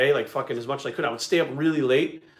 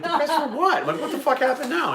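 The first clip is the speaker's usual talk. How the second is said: much higher, laughing disbelief; incredulous; 12 semitones higher than usual. Retold or imitated. Husband baffled by his wife's worry, in high laughing disbelief. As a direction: squeak it out, baffled. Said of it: "I'm like".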